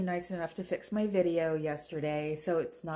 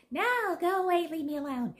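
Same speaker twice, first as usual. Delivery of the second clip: much higher, squeaky put-on cat voice; 9 semitones higher than usual. Mocking the cats, speaking for them in a high cat voice. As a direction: play the cat who wants to be left alone. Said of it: "talk in the cat voice".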